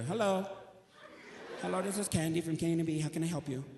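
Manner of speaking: lightly voiced sweet manager answering the phone; sweet receptionist. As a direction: play the friendly radio manager, a bit fast. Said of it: "Candy, the general manager".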